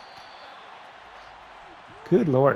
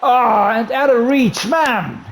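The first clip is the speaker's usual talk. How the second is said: higher, louder groan of dismay; frustrated cry; 6 semitones higher, 4 dB louder, slower than usual. Real, not acted. Gamer reacting to a missed catch, frustrated outburst. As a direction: cry out as the ball slips away. Pass falls just out of reach.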